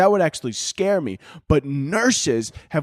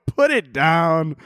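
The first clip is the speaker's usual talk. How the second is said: slow, higher mock verdict; drawn-out mock advice; 4 semitones higher, much slower than usual. Comic repeating advice to dog owner, drawn-out mock plea. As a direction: stretch each word out as a punchline. Repeats the punchline after laughing.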